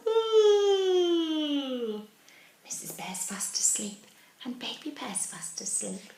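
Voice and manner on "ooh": long, high yawn sliding slowly down; big demonstrated yawn. Shown as a demonstration. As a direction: show the children a huge yawn. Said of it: "Can you do a big yawn?".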